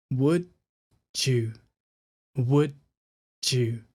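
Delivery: "Would you" said twice, slow and clear, the d running into a "dew" sound; would you drilled. Shown as a demonstration. Teacher drilling "Would you" for learners, clear and slow. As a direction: model "Would you" with the tongue flat at the top. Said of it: "and it's just "dew".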